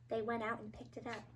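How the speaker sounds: very soft storytelling; tender, quiet telling; hushed narration. A narrator's reading. Tender hush over the lone kitten.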